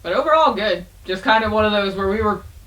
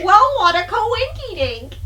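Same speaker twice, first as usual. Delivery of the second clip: silly high voice; 3 dB louder than usual. Acted. Playful silly exclamation.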